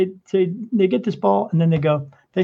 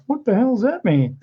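laughing disbelief; 6 semitones higher than usual. Real, not acted. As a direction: question broken by a laugh. Baffled by the box's message.

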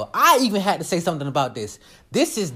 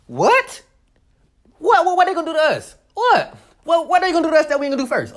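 high-pitched, disbelieving "what"; high, rapid challenge; mock incredulous; 7 semitones higher than usual. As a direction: fire back in indignant disbelief. Mock incredulous challenge about retaliation.

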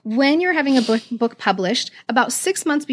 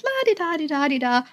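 higher, lilting nonchalant la-di-da; carefree sing-song; 5 semitones higher than usual. Retold or imitated. Author acts out casually opening the box, carefree sing-song. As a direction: mime breezy innocence in sound. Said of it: "I'm like".

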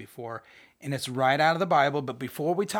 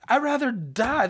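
melodramatic sulk; 9 semitones higher, 4 dB louder than usual. Acted. Jonah's tantrum continued.

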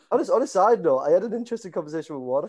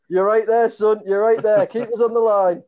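put-on louder, higher voice of an older football manager; manager on phone; 4 semitones higher, 7 dB louder than usual. Manager telling the caller to keep him on hold, calling out. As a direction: call it out down the phone. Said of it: "And he went".

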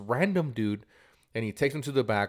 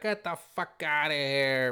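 high, drawn-out imitation of an owner's outburst; mock angry dismissal; 6 semitones higher than usual. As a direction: throw him out, hammed up for the story. Owner throws customer out.

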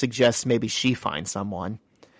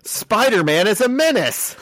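high, playful outcry; hammed-up high-pitched rant; mock denunciation; 10 semitones higher, 4 dB louder than usual. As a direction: proclaim it in mock outrage. Joking denunciation, then laughs.

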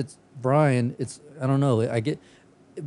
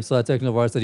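ordinary delivery

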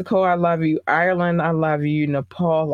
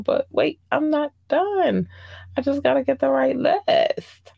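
put-on much higher, sweet baby-talk gushing; cute baby voice; 10 semitones higher than usual. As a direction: coo at the fans like they're puppies.

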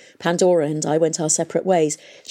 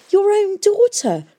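much higher, louder and slow, aghast; horrified cry; 6 dB louder, slower than usual. A narrator's reading. Daughter to her murderous father, horrified high cry. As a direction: cry it out in horror. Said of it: "gasped Catherine".

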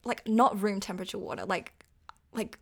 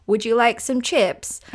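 British accent; 3 semitones higher, 8 dB louder than usual. Shown as a demonstration. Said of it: "That sounded really British for a second".

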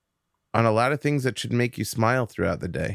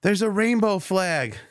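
put-on high, bright-eyed child announcing a discovery; little girl voice; 10 semitones higher than usual. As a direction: play the four-year-old, pitched high. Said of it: "She's like".

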